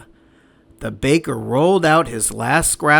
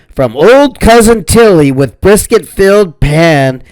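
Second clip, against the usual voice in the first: lightly voiced bragging sing-song; boasting; 3 semitones higher, 10 dB louder than usual. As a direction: boast it with glee. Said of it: "and boasted".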